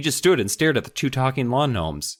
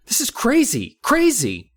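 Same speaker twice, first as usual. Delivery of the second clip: Mindy upset; 12 semitones higher than usual. Acted. Mindy reacts to gnomes.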